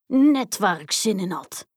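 lightly voiced hungry giant; giant's voice. A narrator's reading. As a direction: relish it as a storybook giant. Giant delighted to find children. (licht aangezette reuzenstem)

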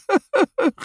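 high, loud squealing laugh; shrieking laugh. A narrator's reading. Bas howls with laughter in the ditch. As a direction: howl with laughter. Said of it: "giert hij".

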